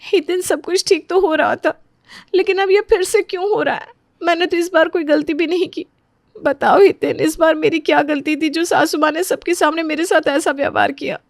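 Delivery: high, hurt, plaintive voice; tearful. Acted. Wife asks husband what she did wrong, tearful. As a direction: plead through tears, pitched high. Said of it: "वो रोते हुए अपने कमरे".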